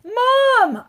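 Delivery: loud, high shout of Mom; child yelling for his mother; yelled. A narrator's reading. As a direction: call out to mother in alarm. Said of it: "I yelled".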